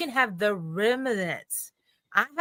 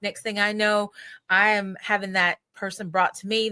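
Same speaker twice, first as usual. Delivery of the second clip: ordinary talk, nothing marked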